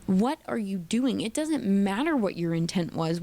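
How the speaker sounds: usual speaking voice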